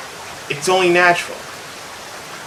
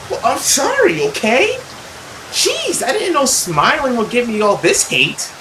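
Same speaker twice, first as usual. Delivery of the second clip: much higher, whiny snippy apology; sarcastic sorry; 6 semitones higher than usual. Acted. The girl snapping back at her mother, high and sulky. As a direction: toss off a sulky teen sorry.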